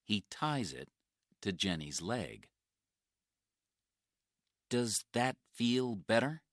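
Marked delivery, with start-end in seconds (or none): 4.7-6.4 caring question. Acted. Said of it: "he asks".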